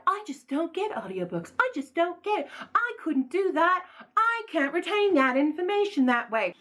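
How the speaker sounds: high-voiced self-parody; mocking past self. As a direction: imitate your old self, high and clueless. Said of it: "There's gonna be a video of me".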